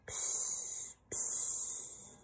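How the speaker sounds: two very quiet voiceless pshes; whispery imitated sleeping breath; soft snoring. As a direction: breathe out long hushed snores. Mouse snores on.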